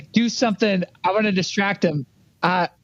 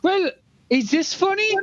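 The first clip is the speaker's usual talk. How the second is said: character voice; 9 semitones higher than usual. Acted. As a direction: stretch it out, pitched up high. Player's character mocks the complaint.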